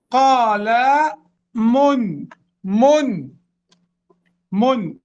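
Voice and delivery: قال من said with the nun clearly sounded; nunation demo. Shown as a demonstration. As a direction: sound the final nun of من distinctly. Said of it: "انظروا النون الساكنة". (نطق النون الساكنة في من بوضوح)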